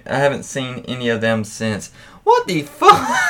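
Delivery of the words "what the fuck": much higher, loud shriek of disbelief; shocked shout. Real, not acted. Host reacting to the listener's disgusting story, shrieking in shock. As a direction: scream it like you can't believe what you just read.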